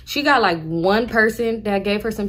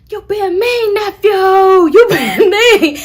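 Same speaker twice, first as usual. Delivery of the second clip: whiny aunt; 12 semitones higher, 10 dB louder, much slower than usual. Retold or imitated. Aunt whines at nephew.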